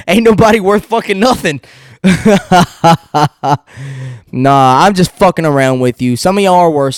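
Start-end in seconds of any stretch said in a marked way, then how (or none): 2.0-4.3 laugh. Real, not acted. Laughs after joking insult.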